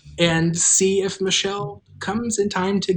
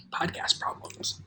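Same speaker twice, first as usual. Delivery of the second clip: whispered aside; 12 dB quieter, slower than usual. Real, not acted. Aside while linking.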